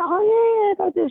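high, loud "oh" of remembering; retold sudden recognition. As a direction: act out it suddenly coming back to you.

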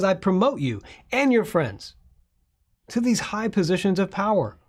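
high, loud royal decree; magnanimous king. Retold or imitated. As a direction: grant favours like a pleased king. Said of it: "And he says".

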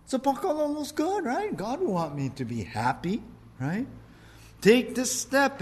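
high, eager rationalizing voice; mock eager self-justifying; persuaded believer. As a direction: talk yourself into it brightly. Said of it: "I heard someone tell me this once".

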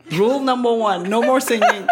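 plus haut et plus fort, en ordre comique; règle martelée. Retold or imitated. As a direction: marteler l'interdiction avec une exaspération jouée. Répète l'interdiction à Marco. (règle martelée, voix haute et forte)

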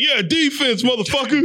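high-pitched, aggressive cheer imitation; mock cheer shout. Hard cheerleader retelling his cheers, mock shout. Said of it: "I had to shout that shit".

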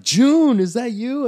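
high-pitched, delighted shout of recognition; excited call. Retold or imitated. Spotting high school friend at security, excited call.

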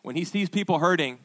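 ordinary talk, nothing marked

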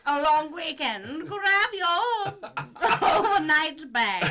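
high, loud, drawn-out whoop; excited invitation. Acted. Partner announcing a surprise trip, loud and excited. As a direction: call it out gleefully, breaking into laughter.

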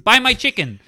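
high, loud mock vendor cry; insistent Vietnamese vendor. Retelling chicken seller hassling men on boat, insistent cry.

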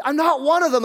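loud, high-pitched denial; vehement denial. Acted. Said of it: "invoking curses on himself".

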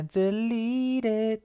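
slow, rising high pitch; playful drawn-out aside. Pretend. Joking about cutting the oversharing, slow rising singsong. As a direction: stretch the last word upward. Playfully stretches words for comic effect.

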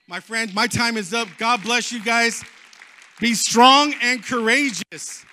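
loud, slow, proclaimed blessing; rousing benediction. Real, not acted. Preacher closing his message to the congregation, rousing blessing. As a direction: send them out with a booming charge.

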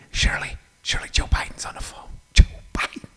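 hushed, excited stage whisper; breathless secret whisper with the phone muffled; urgent whisper. Retold or imitated. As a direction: whisper to the wife so the caller won't hear. Phone against shirt, calling wife.

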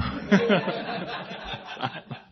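breathy laugh; laughing at himself. Real, not acted. Laughing at own story.

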